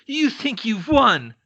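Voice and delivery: high, loud, slow words through a mad cackle; chained Crouch gloats at Neville, cackling slowly; cackling villain. Acted. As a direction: gloat and laugh like a madman. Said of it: "Crouch cackled".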